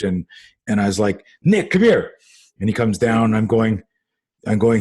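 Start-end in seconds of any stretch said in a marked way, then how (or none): 1.4-2.1 re-enacted dad's summons, pitched high; high, sing-out parental call. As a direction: call a child from another room. Dad calling his son.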